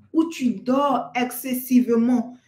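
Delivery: ordinary delivery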